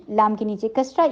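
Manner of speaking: usual speaking voice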